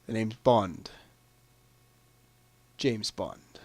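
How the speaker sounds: low, quiet delivery of a film line; iconic catchphrase. Acted. Quiz player reading out the famous Bond phrase, low and understated. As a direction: say it low and soft, dropping off at the end.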